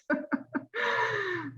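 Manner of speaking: high, soft trailing laugh; real laugh rising high above speech; sympathetic laugh. Real, not acted. Coach laughing with Mary over her stress, sympathetic.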